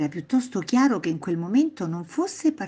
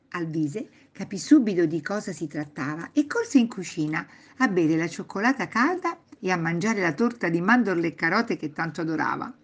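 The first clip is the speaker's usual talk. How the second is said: ordinary delivery, nothing marked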